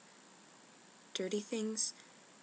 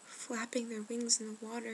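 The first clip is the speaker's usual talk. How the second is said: ordinary talk, nothing marked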